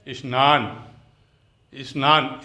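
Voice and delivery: 'is-naan' for स्नान, as Hindi speakers say it; everyday Hindi pronunciation. Shown as a demonstration. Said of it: "हम लोग क्या बोलते हैं हिंदी में".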